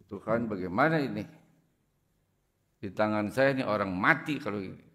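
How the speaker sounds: ordinary talk, nothing marked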